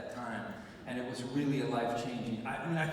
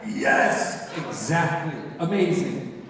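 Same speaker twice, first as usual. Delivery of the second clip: loud, gushing enthusiasm; over-eager agreement; 9 dB louder, much slower than usual. Retold or imitated. Actor cheering on the director's two words, over-eager. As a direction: burst out in eager approval.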